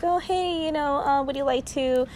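high, sugary customer-service pitch; sweet sales voice. Shown as a demonstration. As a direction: ask sweetly, as if coaxing a customer. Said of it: "It's usually, like, sweet".

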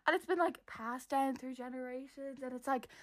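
tiny, high, very soft voice; flustered excuse. Retold or imitated. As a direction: shrink the excuse to a small high squeak. Retold flustered cover story trailing on.

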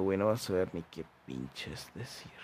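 usual speaking voice

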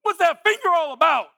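loud high whoa at the rude drivers; indignant shout. Retold or imitated. Driver yelling at women flipping him off, indignant shout. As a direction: shout back in mock outrage. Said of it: "And I was like".

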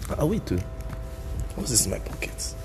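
usual speaking voice

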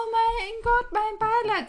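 high, dramatic gasp of pity; imitating a shocked listener; mock shocked sympathy. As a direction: overplay the horror. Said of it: "und dann so, „Oh".

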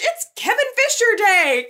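high, loud mock celebration; excited cheer. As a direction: proclaim it like a party announcement. Nurses throw a hospital party.

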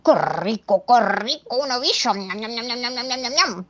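smack your lips like a gobbling beast; greedy monster. Acted. Monster gobbling Mrs Moe's paintbrushes, greedy and delighted.

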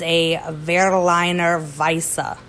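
"Berliner Weisse" said the German way, slow, with a V sound; German pronunciation. Shown as a demonstration. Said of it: "that's how you say it".

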